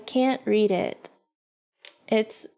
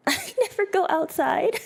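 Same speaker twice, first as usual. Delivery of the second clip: high, laughing confession; giggly self-mockery; real laughing; 10 semitones higher than usual. Real, not acted. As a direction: laugh through the words. Laughs at own homebody habit.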